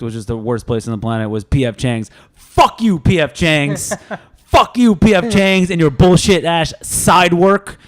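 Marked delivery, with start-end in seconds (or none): 2.3-7.8 loud shouted curse, dragged at the end; mock furious rant. Real, not acted. Cursing former employer.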